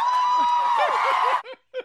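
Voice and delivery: high, loud burst of laughter. Real, not acted. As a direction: crack up hard.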